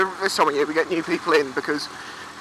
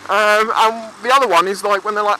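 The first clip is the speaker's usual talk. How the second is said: ordinary talk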